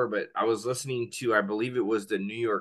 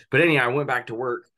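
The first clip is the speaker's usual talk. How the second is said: ordinary delivery, nothing marked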